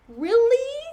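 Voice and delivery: loud, high incredulous question; rising disbelief, pushed loud. Real, not acted. Mock incredulity at the rumor.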